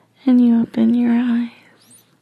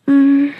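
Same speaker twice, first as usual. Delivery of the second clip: pleasured moan; 2 semitones higher, 7 dB louder than usual. Acted. Pleasure sound mid-scene.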